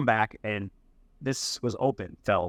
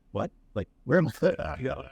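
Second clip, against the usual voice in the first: slow, stunned mock disbelief; mock confusion; much slower than usual. Bartender offers a dirty Corona, slow mock bewildered. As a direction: drag it out like you can't believe your ears.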